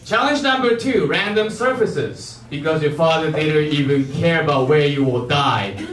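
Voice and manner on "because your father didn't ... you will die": usual speaking voice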